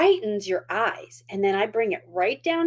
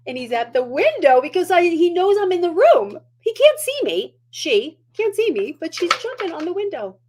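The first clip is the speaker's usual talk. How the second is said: excited about dog; 8 semitones higher, 6 dB louder than usual. Real, not acted. Dog jumping at the window.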